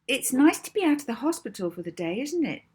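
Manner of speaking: high, quick mock-kind voice, as if to a patient; kindly. A narrator's reading. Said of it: "Molly said to Edna kindly".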